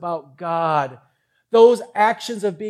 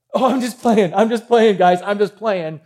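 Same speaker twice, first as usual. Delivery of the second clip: mock backpedalling; 3 semitones higher, 7 dB louder than usual. Samson backpedals when captured.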